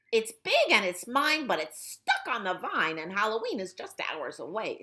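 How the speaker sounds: high, loud cackling-witch voice, rushing at the end. Acted. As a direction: play the bossy witch claiming the pumpkin. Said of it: "said the witch".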